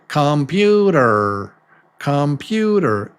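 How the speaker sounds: "computer" with every vowel full, KAHM-PYOO-DER; stretched, unreduced "computer"; slow and robotic. Shown as a demonstration. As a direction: say each syllable of "computer" fully, robot-like. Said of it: "It sounds slow and robotic".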